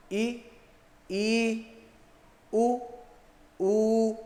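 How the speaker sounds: ई short then ई held long, उ short then ऊ held long; short and long vowels. Shown as a demonstration. Teacher showing the time difference between vowel pairs. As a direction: let the long vowel ring twice as long. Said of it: "समय का अंतर है".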